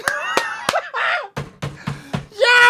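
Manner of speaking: a high, shouted laugh of pure joy; screaming laugh. Real, not acted. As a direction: laugh out loud in disbelief. Laughing during goal celebration.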